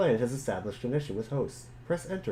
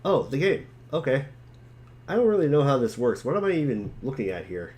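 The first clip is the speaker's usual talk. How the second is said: louder, brighter eager voice; eager realization; 2 semitones higher, 5 dB louder than usual. Acted. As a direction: rush in excitedly, a bit lost. John remembering the game.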